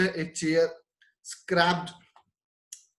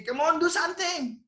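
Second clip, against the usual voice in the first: put-on eager child; 12 semitones higher than usual. Child urges the father.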